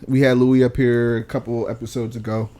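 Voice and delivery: usual speaking voice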